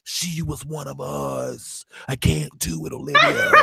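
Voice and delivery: very slow, low, hushed mock-dramatic voice; Huck. Recapper playing Huck refusing Olivia, slow low dramatic refusal. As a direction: draw out each word in a low, brooding near-whisper. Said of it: "Huck is like".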